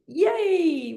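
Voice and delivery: high, drawn-out cheer. Shown as a demonstration. Class celebrates after the exercise, high cheer. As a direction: let out a long high yay.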